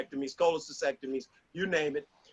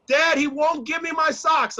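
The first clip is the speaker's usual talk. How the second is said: put-on child complaining; 7 semitones higher, 4 dB louder than usual. Kids complaining to dad.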